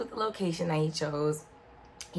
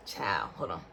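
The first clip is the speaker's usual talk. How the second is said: much lower, softer aside; 10 semitones lower, 6 dB quieter than usual. Real, not acted. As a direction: drop low and mutter it. Aside before taking a drink.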